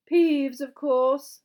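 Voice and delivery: high, loud, fussy gentleman ghost; ghostly gentleman. Acted. House ghost comments on the new pupils, high hopeful voice.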